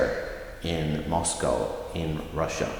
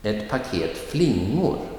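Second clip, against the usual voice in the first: light on Ett paket, lean on flingor; stress on flingor. Shown as a demonstration. Teacher models an example phrase, stress on flingor. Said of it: "the noun will get the emphasis".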